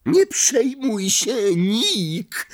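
high, drawn-out call; high-pitched boisterous cry; jeering shout. A narrator's reading. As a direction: holler it with mock comfort. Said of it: "krzyknęła z podłogi głowa".

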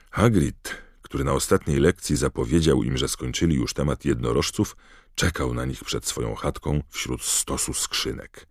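usual speaking voice

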